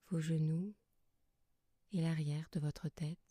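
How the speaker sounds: soft, calm yoga nidra guidance with long pauses; guided meditation. Acted. Yoga teacher settling listeners lying under blankets, soft guided meditation. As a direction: guide them gently into rest, leaving long silences between phrases.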